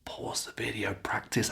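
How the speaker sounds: whispered aside to learners; hushed reminder under the lesson; whispered cue. Shown as a demonstration. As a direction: whisper the instruction softly.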